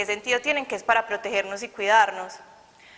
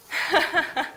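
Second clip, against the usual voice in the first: bright burst of laughter; laughing at her own story; real laugh; 5 semitones higher than usual. Real, not acted. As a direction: laugh at yourself, higher up.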